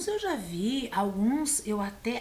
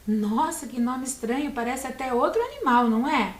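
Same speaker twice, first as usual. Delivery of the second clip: ordinary talk, nothing marked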